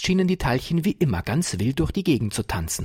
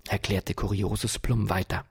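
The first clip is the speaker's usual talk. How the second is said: ordinary delivery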